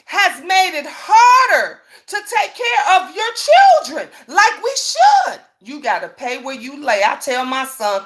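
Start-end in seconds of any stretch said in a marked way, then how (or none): 0.1-5.4 rising, cracking with real emotion; voice breaking. Real, not acted. Mother venting about the system, voice cracking. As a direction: near tears as it climbs. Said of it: "voice breaks with emotion".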